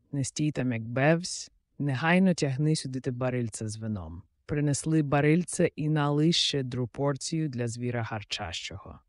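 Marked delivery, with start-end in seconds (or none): none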